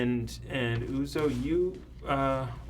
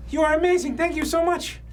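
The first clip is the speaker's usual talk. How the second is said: put-on high, loud, gushing old-timey voice; overjoyed old-fashioned gentleman, gushing; 13 semitones higher, 5 dB louder than usual. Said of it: "The old-timey voice".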